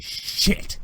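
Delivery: "shit" with a hard, exaggerated final T; accentuated T. Rebel officer defying Thrawn, "shit" with an accentuated T. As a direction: hit the T at the end of "shit". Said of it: "says shit and accentuates the T".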